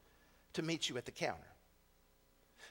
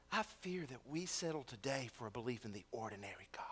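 soft whisper, much quieter; whispered fear; 5 semitones lower, 6 dB quieter, faster than usual. Real, not acted. Preacher confessing his fear to the congregation, whispered. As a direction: confide it under the breath.

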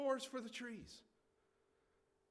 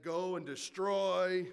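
loud, drawn-out mock command; booming command; 5 dB louder, slower than usual. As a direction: order it slowly and loudly, like sending out a beast. Voices the command to beast.

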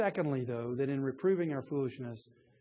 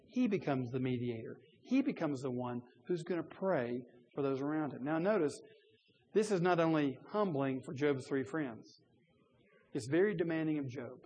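ordinary talk, nothing marked

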